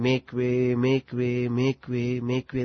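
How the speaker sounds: quietly part the crowd; Swami's make way. Retold or imitated. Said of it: "That was his characteristic style of saying".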